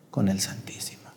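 hushed aside in a whisper. Acted. Priest to congregation, whispering the aside. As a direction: drop to a secret whisper.

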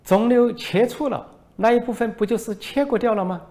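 high, put-on voice of a foreign reporter; mocking reporter voice. Foreign reporter needles the minister, high mocking voice. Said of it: "外国记者说".

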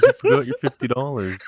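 high, loud quote through laughter; laughing through the punchline; laughing retold quote. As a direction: crack up while quoting him. Retelling a story, cracking up.

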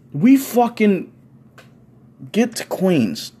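ordinary talk, nothing marked

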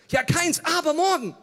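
high, loud, defensive outburst; exasperated protest. Retold or imitated. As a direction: blurt it back at a pressing friend. Acting his exasperated reply to friend. (aufgebracht, laut protestierend)

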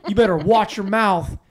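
imitating an indignant bystander; retold confrontation. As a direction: call out a challenge from a distance. Bystander confronts him from the road.

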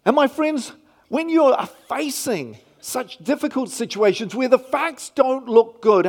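normal talking voice

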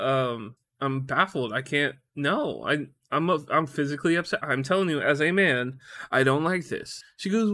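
ordinary talk, nothing marked

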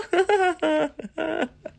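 high, trailing giggle; high-pitched descending laugh; new odd laugh. Real, not acted. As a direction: burst into a high, unfamiliar laugh. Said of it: "sometimes I come up with new laughs".

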